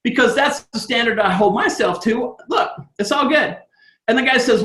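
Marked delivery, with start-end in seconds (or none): none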